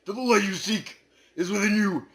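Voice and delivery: normal talking voice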